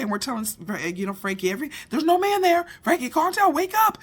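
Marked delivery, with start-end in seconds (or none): 1.5-4.0 high, loud urgent call. Retold or imitated. Family shouts to wake sleepwalking Frankie, high and urgent. Calling sleepwalking brother awake.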